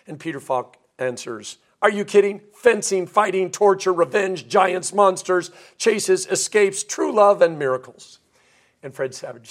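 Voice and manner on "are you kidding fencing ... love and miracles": higher, louder acted hard sell, item by item; enthusiastic sales pitch. Grandfather selling a book to his grandson, enthusiastic. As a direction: reel off the list like an eager storyteller.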